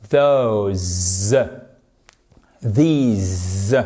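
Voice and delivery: "Those" and "These" drawn out low, ending in a buzzing Z; slow, low demo of the voiced Z ending; final S as Z. Shown as a demonstration. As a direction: lengthen the word and buzz the final S as zzz. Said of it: "à chaque fois, c'est un Z".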